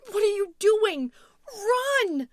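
very high, very loud scream; panicked shout. A narrator's reading. Louise urging Tom to flee, frantic shout. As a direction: scream it at full force in alarm. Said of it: "shouting at Tom".

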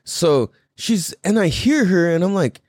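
ordinary talk

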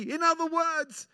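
high, slow, fervent preacher voice; preacher voicing the beggar's resolve; impassioned preaching. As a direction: declare it with fire.

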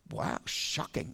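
deadpan, breathy, slow mock shock; mock shocked whisper. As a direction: whisper it like fake astonishment. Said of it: "they don't say it like that".

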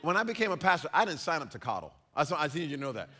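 ordinary delivery

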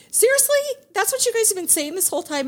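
high-pitched retold disbelief; incredulous. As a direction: confront the boys in mock outrage, pitched high. Retelling her reaction to the boys.

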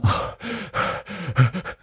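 heavy puffing breaths; winded huffing, no words; panting. Shown as a demonstration. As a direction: pant hard after jumping around. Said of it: "I'm panting".

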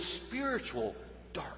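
hushed whisper. Acted. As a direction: lean in and whisper it. Sermon aside on spiritual darkness.

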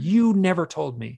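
high, loud, drawn-out accusing cry; anguished accusation. Retold or imitated. Hypnosis client re-enacting accusing the beings, slow anguished protest. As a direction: drive the accusation home, slowly. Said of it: "I'm pointing to these beings and I'm going".

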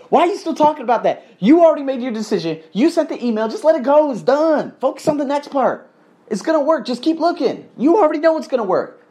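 high-pitched imitation of an exasperated, encouraging friend; insistent friend. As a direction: push him to let it go, high and emphatic. Voices friend urging him on.

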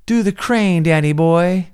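high, loud imitated villain's jeer; mocking taunt. Villain goading Danny boy to do the crane, mock taunt.

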